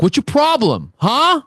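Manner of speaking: loud, high, hostile street challenge; hammed-up Brooklyn tough guy snapping at a stranger; angry Brooklyn guy. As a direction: bark it like a tough guy picking a fight. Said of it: "The guy looks at me, he's like".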